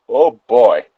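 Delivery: ordinary talk, nothing marked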